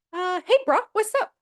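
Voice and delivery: high, bright-pitched imitation of Monica going casual; mock casual bro. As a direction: chirp it like a fake-cool sibling. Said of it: "In no world does Monica say".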